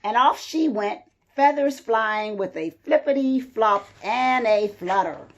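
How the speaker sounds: ordinary delivery, nothing marked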